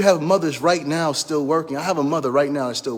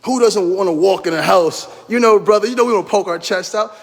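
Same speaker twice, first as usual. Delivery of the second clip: ordinary delivery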